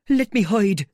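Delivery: high, loud tearful begging; tearful plea. Acted. Marvel begging the men to hide him, in tears. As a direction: plead through tears. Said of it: "with tears running down his face".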